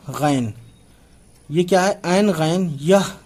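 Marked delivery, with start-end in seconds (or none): 2.1-3.1 slow naming. Shown as a demonstration. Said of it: "teacher names letters slowly".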